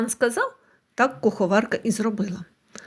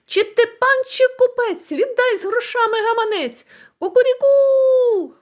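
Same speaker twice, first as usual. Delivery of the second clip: much higher, loud rooster cry with a long drawn crow; 11 semitones higher, 4 dB louder than usual. A narrator's reading. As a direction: crow it out again, relentless. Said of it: "почав".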